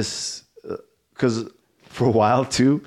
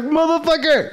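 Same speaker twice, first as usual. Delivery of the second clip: mock outrage. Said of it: "I'm like".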